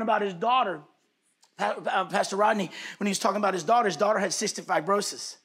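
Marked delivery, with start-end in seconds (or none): none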